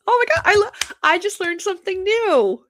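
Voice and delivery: high, loud gushing over the comments; thrilled. Real, not acted. Host gushing to viewers over comments, excited. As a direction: squeal with delight at the new trick. Delighted at learning screen trick.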